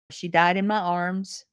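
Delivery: ordinary delivery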